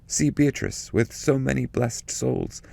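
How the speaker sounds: solemn, fervent poetic prayer, held high throughout; prayer recitation. Acted. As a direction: lift the voice and pray each line to the Virgin. Recites Bernard's prayer from canto.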